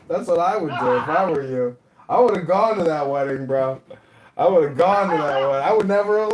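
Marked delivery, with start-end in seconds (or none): none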